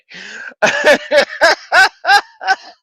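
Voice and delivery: a burst of loud laughter; loud cackling laugh. Real, not acted. Laughing loudly at his own joke about Sean Bean. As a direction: cackle at your own punchline.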